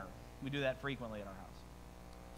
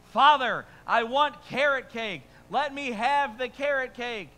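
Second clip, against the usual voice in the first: higher, louder, slow hammed-up plea; mock cry out; 8 semitones higher, 10 dB louder, slower than usual. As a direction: cry out to father like a desperate psalmist. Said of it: "He cried out to his father".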